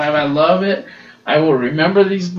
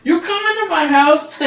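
much higher, louder mock rage; furious outburst; 13 semitones higher, 5 dB louder than usual. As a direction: imitate the butler exploding at the intruder. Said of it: "Alfred's like".

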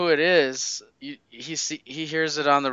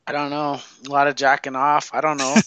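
ordinary talk

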